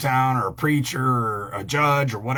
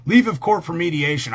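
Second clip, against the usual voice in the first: usual speaking voice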